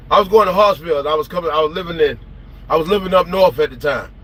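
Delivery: ordinary talk, nothing marked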